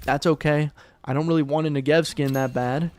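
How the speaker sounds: normal talking voice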